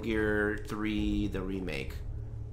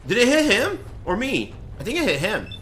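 high, loud, unsure calls; excited confusion; 11 semitones higher, 7 dB louder than usual. Real, not acted. Gamer unsure whether the blast hit the enemy. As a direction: ask it fast in the chaos.

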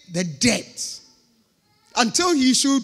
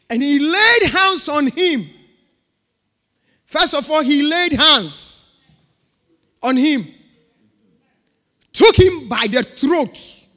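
loud, slow and higher, hammering each word; forceful preaching; 4 semitones higher, 8 dB louder, slower than usual. Acted. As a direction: thunder it from the pulpit, slowly. Preacher acting out the choking.

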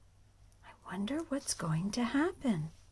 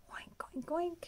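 whispered oinks; 7 semitones higher, 5 dB quieter than usual. Acted. Piglets sneaking while mommy sleeps.

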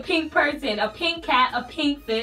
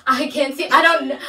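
ordinary talk, nothing marked

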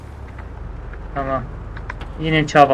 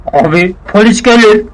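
higher, louder warning cry; alarmed yell; 5 semitones higher, 5 dB louder than usual. Acted. Sidekick warns his boss the police will come, alarmed yell. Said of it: "Ne bağırıyorsun oğlum?".

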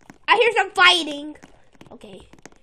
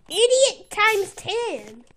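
slow insult; 2 semitones higher, 3 dB quieter, much slower than usual. Real, not acted. Insulting a player.

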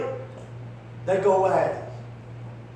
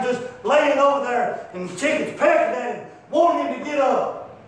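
normal talking voice

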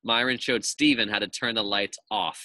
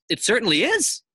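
teacher's firm insistence; 11 semitones higher, 6 dB louder than usual. Acted. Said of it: "said Missus Jules".

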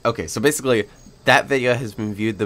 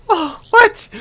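shocked surprise; 10 dB louder than usual. Retold or imitated. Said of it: "I'm just like".

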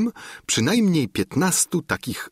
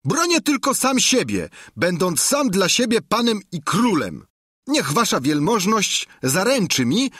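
high-pitched squire laying down terms; defiant high voice, building to a threat; Sancho, defiant; 10 semitones higher than usual. A narrator's reading. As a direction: stand up to your master in a high voice.

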